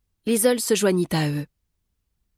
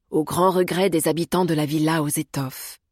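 ordinary delivery

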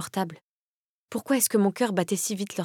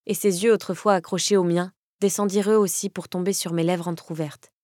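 ordinary delivery, nothing marked

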